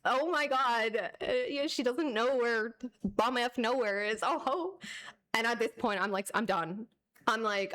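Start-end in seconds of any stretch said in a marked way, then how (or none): 0.0-4.8 imitating their scornful laughing disbelief; mock laughter. Streamer imitating the tourists laughing at her, mock laughter. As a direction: laugh mockingly through the words, voice raised. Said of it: "And they laughed".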